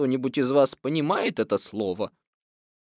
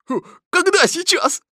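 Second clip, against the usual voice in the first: high, loud, flustered tiger; caught-out, slightly sheepish question; slightly embarrassed; 14 semitones higher, 5 dB louder than usual. A narrator's reading. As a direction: stall with a hum, then ask high. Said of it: "слегка смутившись".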